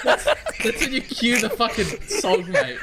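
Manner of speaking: cracking up mid-sentence; high giggly laughing talk. Real, not acted. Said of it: "Goes in the diarrhea too".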